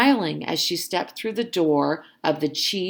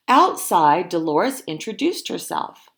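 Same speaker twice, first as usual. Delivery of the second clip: ordinary delivery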